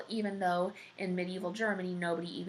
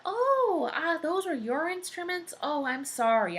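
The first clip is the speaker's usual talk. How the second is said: hammed-up high, loud "oh" of surprise; surprised realization; 10 semitones higher, 5 dB louder than usual. Giant learning the instruments were Jack's, surprised and apologetic. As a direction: let it dawn on you, voice jumping up.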